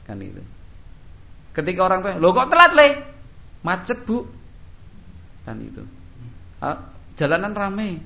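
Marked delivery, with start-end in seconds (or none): 2.2-2.9 high, loud startled mother's call; a mother catching her late son out; surprised mother. Acted. Mother to late son, high and loud surprise. 3.6-4.3 put-on higher child's voice with an excuse. Child excusing himself to mother, higher voice. 6.6-8.0 higher, slow offhand excuse; casual excuse. Acted. Child brushing off lateness to parent, slow and offhand.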